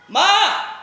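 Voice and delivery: loud, high call for mum. Acted. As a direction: shout across the house for mum. Calling mother from afar. (माँ को ज़ोर से पुकारना)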